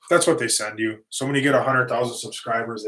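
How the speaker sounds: usual speaking voice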